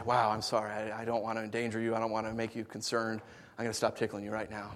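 soft sarcastic sorry, as the brother never said; mock gentle apology. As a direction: quietly pretend a kind older brother. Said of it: "He'd stop and say".

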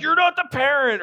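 high-pitched imitation of parents; mock parental scolding. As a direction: correct the child, hammed up. Parents scolding in retold scene.

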